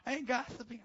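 defensive denial. Acted. Mimics indignant churchgoer's denial.

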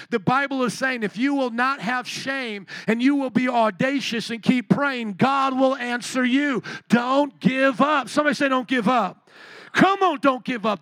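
high, forceful pulpit exhortation; emphatic preaching. Real, not acted. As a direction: preach it up to a loud rallying call. Preacher builds to a rallying call.

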